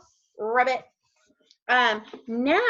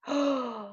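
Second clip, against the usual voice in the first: long, soft gasp; shocked gasp; 2 semitones higher, 5 dB quieter than usual. Pretend. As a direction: draw breath in as if amazed. Reader reacts to the page.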